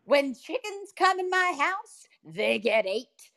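high, slow, booming Southern church lady; Southern lady impression. Imitating a Southern lady telling the world the ocean is the shark's house. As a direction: preach it like a big boisterous Southern woman. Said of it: "this big Southern lady".

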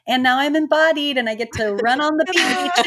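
mock triumphant. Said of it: "It's not like I'm like".

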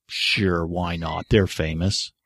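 low, soft mock-casual shrug; deadpan sarcastic giving in; sarcastic agreement. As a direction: concede it with dry, offhand irony. Sarcastic list of people to baptize.

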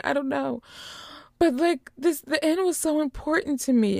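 upset Jess. Retold or imitated. Jess pleading with David.

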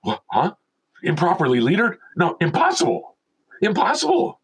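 rising, mock incredulous denial; squeaky high final impossible; disbelief. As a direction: protest it can't be true. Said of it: "And I'm like".

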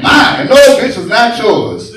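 put-on high, loud yell at a kid; mock shout. Scolding the girlfriend's destructive kid with a shout. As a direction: snap back at the brat, loud.

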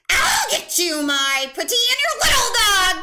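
shrieking high witch voice, very loud; cackling menace, screeched at full volume; Wicked Witch of the West. Acted. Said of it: "from Liddy into the Wicked Witch in the West".

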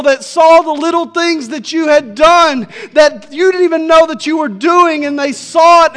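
high, loud impassioned preaching, slowing on key lines; full-force preaching. Acted. Preacher telling the congregation Jesus saw their pain, shouting with passion. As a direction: preach it out, voicing Jesus' comfort with rising fire.

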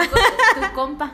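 slow teasing drawl. Acted. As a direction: draw it out mockingly. Teasing drawn-out jab. (burlón)

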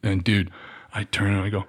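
normal talking voice

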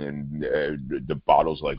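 normal talking voice

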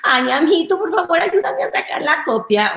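mock girly office favor request; wheedling coworker. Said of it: "llegas al trabajo".

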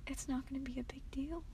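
whispered aside. Real, not acted. Vlogger whispering an aside to her listeners.

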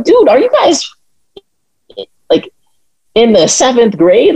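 high, hammed-up disbelief; mock incredulous. Mocking the Proud Boys' initiations, high incredulous scoff. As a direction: scoff at them like they are children. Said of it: "I'm like".

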